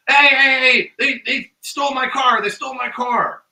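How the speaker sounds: high, loud panicked cry for help; imitated screaming. Storyteller acts a man running into the store, screaming. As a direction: re-enact a frantic shout. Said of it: "and he's screaming".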